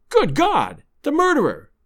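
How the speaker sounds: high-pitched, horrified exclamation; choked horror. A narrator's reading. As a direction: narrator voicing a man choking with fright. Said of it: "he choked".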